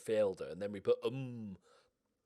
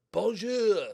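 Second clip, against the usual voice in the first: loud, high French shout; French accent; 9 semitones higher, 11 dB louder than usual. Acted. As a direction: call it out with a put-on French accent. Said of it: "I'm doing a French accent for a Belgian".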